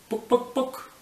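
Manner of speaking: high, loud clucked "Book, book!"; chicken cluck. Acted. Chicken clucking at the librarian, high and loud. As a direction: squawk like a hen.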